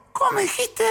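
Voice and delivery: very high squeaky question; comic falsetto "come again?"; baffled mock query. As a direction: ask it way up high, as if you didn't catch it. Acted reply, hard of hearing.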